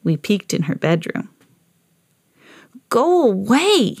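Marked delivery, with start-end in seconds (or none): 2.9-4.0 slow grumpy sister voice; grumpy big sister. A narrator's reading. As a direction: drag it out like a cranky sister. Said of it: "said my sister".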